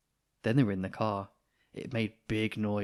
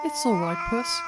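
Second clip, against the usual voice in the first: cooing nurse; 5 dB louder than usual. Acted. Nurse soothing the cat.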